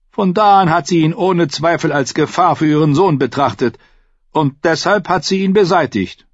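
an airy courtier shifting the guilt; smug lord. A narrator's reading. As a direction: casually lay out the blame. Narrator voices Lord Florent.

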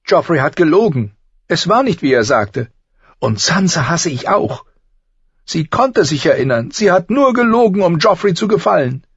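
put-on high, loud girl's voice, worked up; young Arya pouring out her anger; upset girl. A narrator's reading. (aufgebrachtes Mädchen, hoch und laut)

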